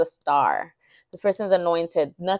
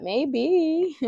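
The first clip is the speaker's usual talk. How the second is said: "Maybe." echoed much higher, hamming up the lilt; mock her own tune on "Maybe.", pitched way up; a playful, much higher re-enactment of "Maybe."; exaggerated sing-song repeat; 10 semitones higher than usual. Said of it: "I don't know why I said it like that".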